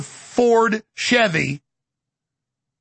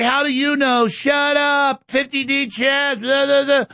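higher, heckling mock voice; mocking critics; 5 semitones higher, slower than usual. People scoffing at his prediction, mocking. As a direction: jeer it like a dismissive critic. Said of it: "people wanna say".

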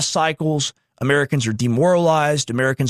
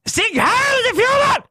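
mock Nazi salute shout; slower than usual. Mocking the Israeli lawyer with a high mock salute shout. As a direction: bark it out high like a mock rally chant.

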